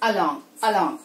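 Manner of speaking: along with a light unstressed first "a"; lightly touch the first a of along; weak vowel demo. Shown as a demonstration. Teacher modelling the weak a in along. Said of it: "ఆ sound ని specific గా".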